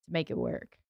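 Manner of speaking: normal talking voice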